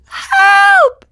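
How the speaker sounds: high, loud, tearful shout; high sobbing cries for help; crying for help. A narrator's reading. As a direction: scream for help, terrified puppet. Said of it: "burst into sobs and tears".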